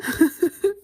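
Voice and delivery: bright high giggle; high laugh. Real, not acted. Laughing at daughter's question.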